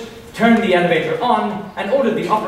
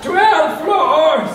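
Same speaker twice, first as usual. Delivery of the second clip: put-on old man voice; 9 semitones higher, 4 dB louder than usual. Old man's line in story.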